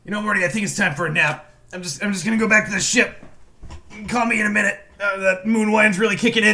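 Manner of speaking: a hint of a drowsy, boozy mad scientist; half-hearted tipsy old genius; lightly voiced Rick. Rick tells Morty he's off for a nap, mildly tipsy.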